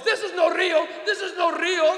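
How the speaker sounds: high, loud, disbelieving dad voice; imitated father. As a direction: deny it as an astonished Dad. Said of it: "And he goes".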